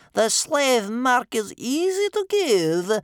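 high, loud, drawn-out grandmother voice; slow, menacing. A narrator's reading. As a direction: spell out the threat slowly. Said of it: "said Bear Cub's grandmother slowly".

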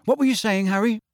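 put-on brisk, high-pitched boyish urging; brusque change of subject; gruff. A narrator's reading. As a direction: hurry them along impatiently. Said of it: "said Ron gruffly".

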